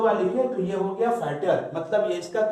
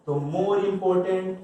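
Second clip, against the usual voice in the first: "more important" slow, leaning on "more"; stress on more; much slower than usual. Shown as a demonstration. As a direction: draw out "more" as a demo. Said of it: "तो इसको comparative बनाएंगे". ("more" पर ज़ोर देकर धीरे)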